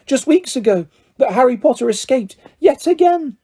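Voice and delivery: high, fluttering elf voice; a small creature overjoyed to meet his hero; eager house elf. A narrator's reading. Elf meeting Harry Potter at last, high and eager.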